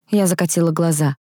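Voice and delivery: usual speaking voice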